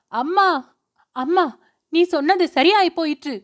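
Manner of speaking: a touch of terrified wailing. A narrator's reading. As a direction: call for her mother between sobs. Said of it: "என்று அலறிக் கொண்டிருந்தாள்".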